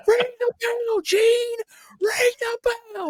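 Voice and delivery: very high, strained yelling referee; shrill cartoon coach shouting at the commentator; frantic referee yell. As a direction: scream it like a red-faced referee losing it. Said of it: "looks like Coach Buzzcut".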